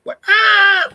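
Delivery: high, loud imitated shriek; high mock shriek. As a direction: shriek like she's losing it. Girlfriend's friend goes nuts.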